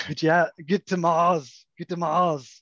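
put-on slow, heavy action-hero accent; Arnold Schwarzenegger voice. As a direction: growl it like a movie strongman. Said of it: "you go to the Arnold voice".

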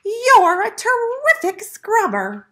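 high, loud, delighted piglet. A narrator's reading. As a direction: squeal the compliment in a high voice. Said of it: "said the piglet".

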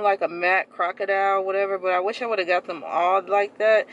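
muffled behind cloth mask; speaking masked in car; through a face mask. Real, not acted.

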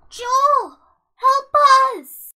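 high, loud desperate shout; shouted plea, pitched right up; urgent cry for help. Acted. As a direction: yell for a friend to come to the rescue. Calling for help in danger.

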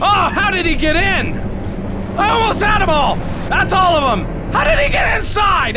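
high-pitched yell of disbelief; exasperated shouting. Real, not acted. Player just lost what he almost had, exasperated shouting. Outraged player losing a near win.

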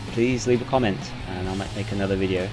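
usual speaking voice

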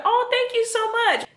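high, drawn-out mock-polite thanks; outwardly grateful. Woman thanking her date on the outside, feigned gratitude. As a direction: gush politely while hiding what you think. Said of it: "But on the outside, I was like".

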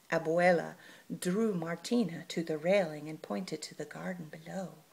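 usual speaking voice

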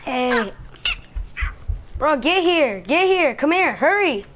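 ordinary talk

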